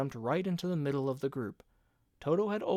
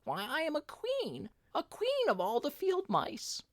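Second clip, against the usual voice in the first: much higher, tiny regal mouse voice; a tiny mouse proudly declaring herself queen; 13 semitones higher than usual. A narrator's reading. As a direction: announce your rank proudly in a squeak.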